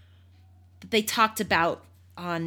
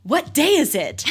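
high, loud eager question; hammed-up urgent shout to a passer-by; excited Scrooge; 9 semitones higher, 6 dB louder than usual. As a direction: call it out as a giddy Scrooge. Acts Scrooge calling to the boy.

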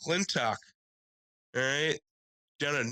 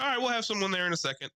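mock dispatcher voice; 9 semitones higher, faster than usual.

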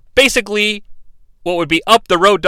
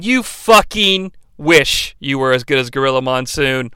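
ordinary talk

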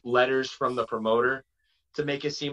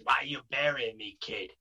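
put-on light Boston accent; Jason Rumble; 4 dB quieter than usual. Wrestler complaining to a younger colleague, light Boston accent. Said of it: "so he talks like this".

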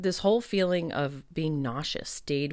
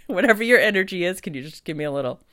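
playful pleading; 4 semitones higher, 6 dB louder, faster than usual. Retold or imitated. Craving a woman's warm energy.

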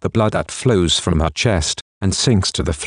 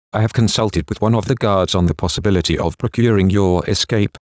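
usual speaking voice

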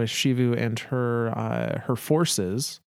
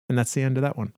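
ordinary delivery

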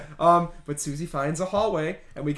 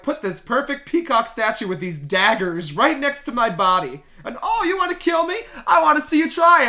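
higher, louder mocking dare; taunting witch; 5 semitones higher, 4 dB louder than usual. Old witch daring Susie to kill her, high taunt. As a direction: goad her to try. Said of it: "we were like".

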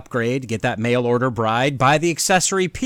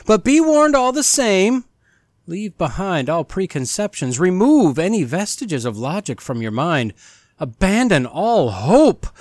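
ominous announcer; 3 semitones higher than usual. Acted. Host welcoming listeners to a spooky podcast, theatrical announcer voice. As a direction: lure listeners in like a haunted house guide.

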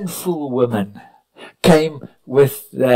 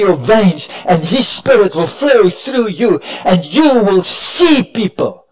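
full-force preaching; 5 semitones higher, 5 dB louder than usual. Acted. Preacher pressing the church to a new heart, loud full-force preaching. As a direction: preach it home over the music. Preaching climax over music.

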